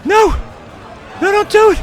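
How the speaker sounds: very high, very loud panicked scream; desperate scream. Real, not acted. Gamer screaming as the countdown hits zero. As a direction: scream no like it's all lost.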